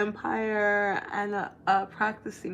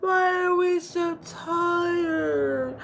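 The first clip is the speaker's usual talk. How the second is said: much higher, very slow, confused scarecrow voice; dazed scarecrow; 8 semitones higher, 4 dB louder, much slower than usual. Retold or imitated. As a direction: stretch it out, dopey and bewildered. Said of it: "He's like".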